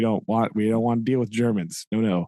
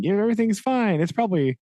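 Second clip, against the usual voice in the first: higher, hammed-up reassurance that it's fine; a lightly voiced jittery soldier passing the buck; mock nervous bluff; 7 semitones higher, faster than usual. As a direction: play a soldier nervously insisting all is fine.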